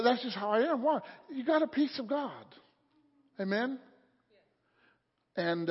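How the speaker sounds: ordinary talk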